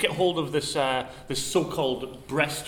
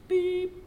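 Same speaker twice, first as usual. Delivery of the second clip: high, long phone tone; high mock beep; imitated beep; 13 semitones higher than usual. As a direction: make a dial tone with your voice.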